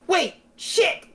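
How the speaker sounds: high-pitched mock alarm; hammed-up jolt of panic; sudden alarmed realisation. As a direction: act out catching a mistake just in time. Acts out the Doctor's sudden realisation.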